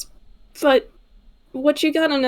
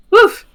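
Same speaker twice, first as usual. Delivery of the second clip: a loud, much higher dog bark; 10 semitones higher, 10 dB louder than usual. Acted. Player barks between lines, a loud bark.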